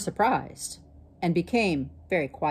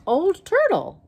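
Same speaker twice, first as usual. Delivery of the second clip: put-on high, loud Old Turtle revealed; surprised reveal; 13 semitones higher, 5 dB louder than usual. A narrator's reading. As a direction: announce it with wonder.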